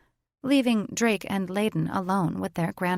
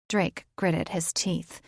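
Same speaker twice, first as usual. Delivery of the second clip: plain reading voice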